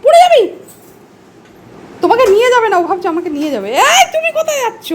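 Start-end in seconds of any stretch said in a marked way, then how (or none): 0.0-0.4 high, loud alarmed cry; startled loud warning; sharp warning. Real, not acted. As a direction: stop the pet before it falls. Sudden warning to the pet.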